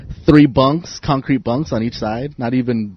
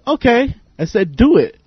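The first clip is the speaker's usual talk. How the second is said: high, challenging "do it"; cocky dare; 10 semitones higher than usual. Retold or imitated. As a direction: dare him coolly. Daring the attacker.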